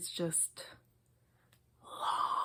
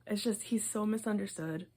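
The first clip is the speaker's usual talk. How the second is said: usual speaking voice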